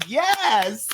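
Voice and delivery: high triumphant yes; mock cheer; silent cheer. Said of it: "in my head, I'm like".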